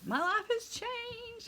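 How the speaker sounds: hammed-up gasp of amazement; mock-dramatic awe. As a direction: oversell it like a miracle. Mock-dramatic reaction to magic pen.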